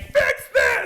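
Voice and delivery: high, loud mock demand "Fix this!"; a hammed-up cry for help; mock yell. Imagining him begging hospital staff, loud mock shout.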